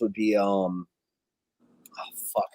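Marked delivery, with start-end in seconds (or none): 2.0-2.5 under-the-breath whispered curse; frustrated whisper while searching memory. Real, not acted. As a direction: hiss it to yourself while trying to remember. Can't recall a title.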